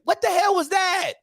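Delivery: what the hell was that shouted high; exasperated shout. Real, not acted. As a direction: yell it at the coach in disbelief.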